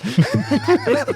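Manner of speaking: a high laugh at his own story; high laughing fit; high burst of laughter. Real, not acted. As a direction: crack up laughing.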